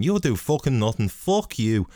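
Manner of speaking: teasing Russian fighter taunt; mock Russian trash talk; mock Russian accent. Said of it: "doing the accents".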